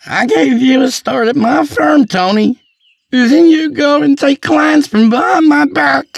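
high, loud wounded outburst; tearful accusation. Acted. Teary man accuses former associate, loud wounded anger. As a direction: shout your betrayal at him. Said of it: "He teared up".

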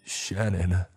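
strained, choked call of her name. Acted. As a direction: voice catching in the throat. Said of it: "he choked out".

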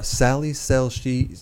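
slow, careful demonstration pace; slow teaching. Shown as a demonstration. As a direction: spell it out slowly for a learner. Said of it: "I'm gonna teach you a tongue twister".

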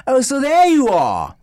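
high, loud goofy spy voice; Austin Powers impression. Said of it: "that one scene in Austin Powers".

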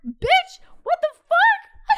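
very high, loud squawk of disbelief; outraged disbelief. Retold or imitated. Reacting to date staying over, outraged. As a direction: shriek it slowly, aghast.

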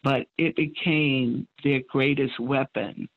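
ordinary talk, nothing marked

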